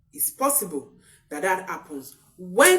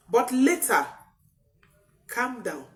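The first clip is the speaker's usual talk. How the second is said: normal talking voice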